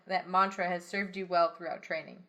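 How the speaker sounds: ordinary talk, nothing marked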